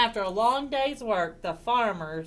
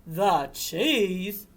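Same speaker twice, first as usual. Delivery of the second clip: dog character. Acted. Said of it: "said the dog".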